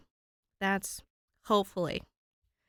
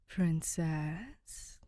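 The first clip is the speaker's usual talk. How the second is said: 1 ghostly voice; 2 semitones lower, 4 dB quieter than usual. Acted. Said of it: "Danny hears, though it seems to make no sound".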